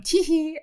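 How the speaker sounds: very high mock giggle; squeaky fake tee-hee; lady's titter. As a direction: titter like a sly schemer. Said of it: "the lady's going".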